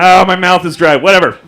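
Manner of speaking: mock irritated old official; old referee. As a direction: call it out like an exasperated ref. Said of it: "an old referee blew the whistle".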